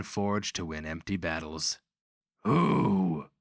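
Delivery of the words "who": loud booming demand; boomed. A narrator's reading. Barbarian booms at the Grand Master, loud demand. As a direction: boom the question across the room. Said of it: "he boomed at the Grand Master".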